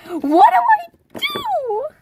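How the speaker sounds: very high, loud, slow wail; mock crying panic. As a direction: wail it like you're about to cry. Panicking about the note.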